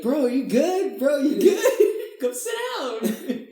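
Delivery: high, slow mock concerned bro voice; stretched out laughing bro voice; friends checking on him. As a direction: imitate them laughing through it. Friends voiced checking on stoned guy.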